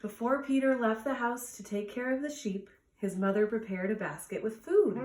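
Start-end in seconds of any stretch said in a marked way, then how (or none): none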